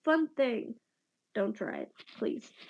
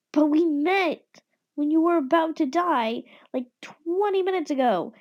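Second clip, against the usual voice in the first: incredulous retort; 4 semitones higher, 5 dB louder than usual. Acted.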